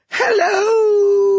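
very high, loud, long-held halloo; yelling across water. A narrator's reading. Old god Ra hails them across the water, high holler. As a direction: holler it high and stretch the last vowel. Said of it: "he yelled across the water".

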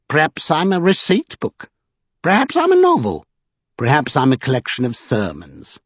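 high, whimsical character voice; self-amused oddball, high-pitched; playful eccentric. A narrator's reading. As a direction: toy with each idea, pitch swooping high. Vinculus amused by his own guesses.

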